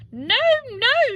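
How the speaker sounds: high shrill-free cry of refusal; giant's wife crying out high and loud; cried out. Acted. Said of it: "she cried".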